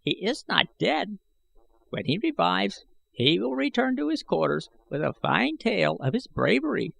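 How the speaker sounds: an ancient schemer speaking up; old man I Gos. A narrator's reading. Old man warning Gahan from the shadows, high and aged.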